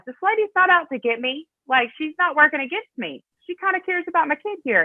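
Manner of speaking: high-pitched, surprised thinking-aloud voice; mother's dawning realization. Retold or imitated.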